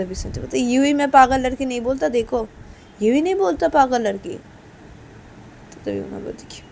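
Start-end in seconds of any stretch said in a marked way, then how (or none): 0.7-4.3 higher, louder justification; acted quarrelling husband's retort; exasperated jibe. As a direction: hammer home the insult, exasperated. Sanju repeats insult in quarrel.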